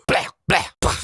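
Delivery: unvoiced, spat-out bleh of disgust; mock gagging. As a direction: splutter as if retching. Retching in disgust at clip.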